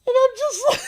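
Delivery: high and loud, laughing while talking. Real, not acted. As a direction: keep cracking up as you speak. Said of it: "I'm laughing".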